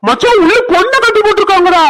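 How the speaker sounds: high, loud alarmed call to his friend; breathless alarm at what he saw; urgent call. Acted. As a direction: blurt out the shocking find. Discovers bound girl, calls friend.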